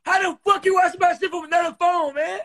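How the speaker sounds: high and loud disbelief; incredulous outburst. Real, not acted. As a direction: demand an answer, baffled. Baffled by stranger joining live.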